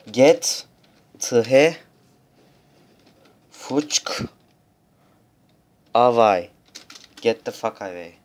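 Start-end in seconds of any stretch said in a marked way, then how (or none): 0.1-6.5 each word punched out loud; loud mock curse. (kelime kelime bağırılan küfür)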